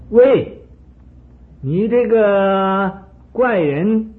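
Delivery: high, loud angry call, slowing on 你这个; a jealous king yells at the hermit; angry shout. A narrator's reading. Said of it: "发起火了". (嫉妒的国王愤怒地喝问)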